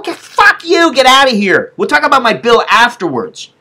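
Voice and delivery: high-pitched angry outburst; re-enacted angry dismissal. Patient sending billing lady away, re-enacted anger.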